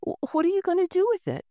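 mock her lightly, quick and amused; joking worker. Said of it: "one of them joked".

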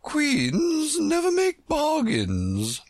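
very high, slow royal pronouncement; stiff, haughty. A narrator's reading. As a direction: draw yourself up and refuse slowly, as a queen. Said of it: "drew herself up rather stiffly and said".

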